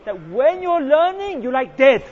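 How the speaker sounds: slow down and push the key point out loud; emphatic. Real, not acted.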